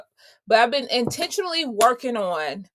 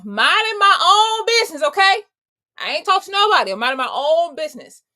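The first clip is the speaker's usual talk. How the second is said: higher and louder, full of attitude; sassy insistence; 6 semitones higher, 6 dB louder than usual. Real, not acted. Telling viewers she bothered nobody at the clinic, loud and indignant.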